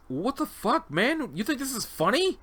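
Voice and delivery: high-pitched, indignant disbelief; incredulous protest. Acted. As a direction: protest as if someone is pranking you. Subject protests at the painting.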